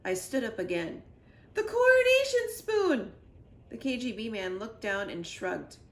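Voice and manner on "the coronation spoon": high, loud cry of recognition; excited shout. A narrator's reading. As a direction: blurt it out, stunned and thrilled. Kid recognizes the stolen spoon.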